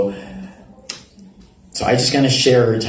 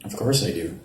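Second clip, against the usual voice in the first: soft confession; 3 semitones lower, 8 dB quieter than usual. Acted. Quiet confession of longing.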